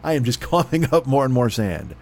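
ordinary talk, nothing marked

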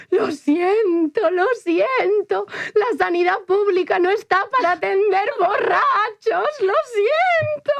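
put-on high, slow, wailing drunken sorry; drunk wailing apology. Apologising to the ambulance man, slow high wail. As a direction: drag it out like a tearful drunk. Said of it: "diciéndole al señor de la ambulancia".

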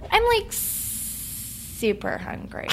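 slow, drawn-out vocal fry; gravelly Valley Girl. Shown as a demonstration. Guest showing the downward Valley Girl version, gravelly fry. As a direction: drag it out into a gravelly creak. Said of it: "it could go down and it turns into a kind of gravelly".

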